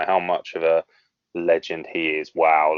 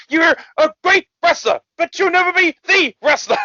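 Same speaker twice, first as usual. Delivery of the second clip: screaming wrestler impression, very high and loud; whoop, then scream the promo in a hammed-up high voice; Ric Flair; 7 dB louder than usual. Coked-up wrestler berating his rival backstage, mock screaming. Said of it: "It's like screaming at him".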